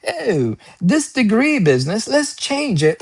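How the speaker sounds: mock British accent for an ancient Greek; lightly voiced British scholar, rising high; playful British accent. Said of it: "Why am I using a British".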